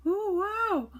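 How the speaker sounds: very high, very loud ooh wow; pained shout. A narrator's reading. Saucepan man attacked above them, shouting in pain, very high. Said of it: "shouted the saucepan man above them".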